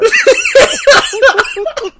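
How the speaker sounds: shrieking burst of laughter; loud laughing fit. Real, not acted. Friend's story sets him off into loud laughter. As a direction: lose it completely laughing. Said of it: "why have you lost it".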